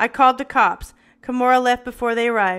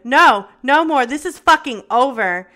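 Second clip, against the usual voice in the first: loud, slow furious shout; 2 semitones higher, 7 dB louder than usual. A narrator's reading. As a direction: yell him out of your life. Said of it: "I shouted at him".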